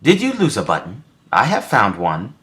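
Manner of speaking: high, small bird voice; chirpy little helper; sparrow voice. A narrator's reading. Sparrow offers Toad a button, high bird voice. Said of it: "said the sparrow".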